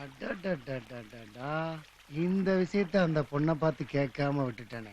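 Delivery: low, drawn-out, hushed lament; rueful aside. Acted. Regretting a question he forgot to ask, rueful low mutter. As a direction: mutter it slowly and low, as if kicking yourself.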